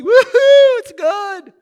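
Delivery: high loud fake woohoo; hammed-up happy-go-lucky cheer; mock cheer. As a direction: overblown fake joy in the pews. Mocking happy-go-lucky churchgoer.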